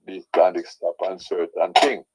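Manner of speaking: ordinary talk, nothing marked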